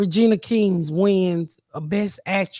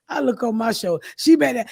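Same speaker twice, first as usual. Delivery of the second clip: usual speaking voice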